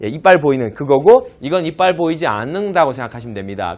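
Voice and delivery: ordinary talk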